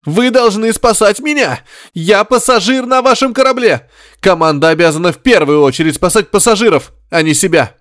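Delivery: shrill, very high panicked pleading; cowardly passenger begging the crew to save him; hysterical demand. A narrator's reading. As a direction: scream for himself, losing control. Reporter hysterically demanding rescue.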